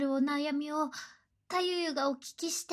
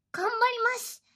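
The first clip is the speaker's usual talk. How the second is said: normal talking voice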